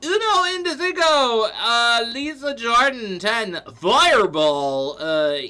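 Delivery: loud, high, slowed-down hype shout-out; excited loud hype. Real, not acted. Streamer thanking gifters during a hype train, loud and excited. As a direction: whoop the gifters' names out like an announcer. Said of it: "I don't know why I'm getting so aggressive".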